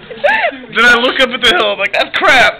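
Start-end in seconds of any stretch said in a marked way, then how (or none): 1.9-2.6 high, loud laughing wail; mock wail. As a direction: shout it through laughter, hammed-up. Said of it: "Act out your wreck".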